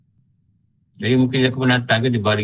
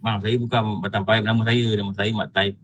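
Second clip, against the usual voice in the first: lower, unruffled sample reply; steady; 4 semitones lower, 4 dB quieter than usual. Shown as a demonstration. Preacher models a reply to being called names, steady. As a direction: correct him politely, keeping it low and even. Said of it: "Steady je cakap".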